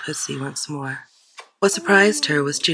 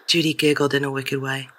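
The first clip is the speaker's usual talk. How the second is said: plain reading voice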